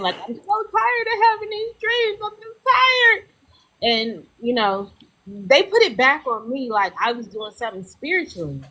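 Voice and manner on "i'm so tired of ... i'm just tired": high tearful cry; tearful, tired of it all. Retold or imitated. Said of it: "I was just crying".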